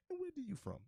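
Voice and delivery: high-pitched imitation of the shop lady; high mock voice of the Nigerian shop lady; imitating the shop lady. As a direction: go way up as the lady asking.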